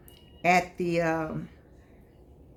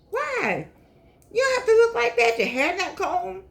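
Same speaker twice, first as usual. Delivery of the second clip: exasperated scolding; 12 semitones higher than usual. Real, not acted. Scolding frumpy older women.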